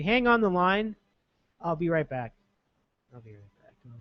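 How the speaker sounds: normal talking voice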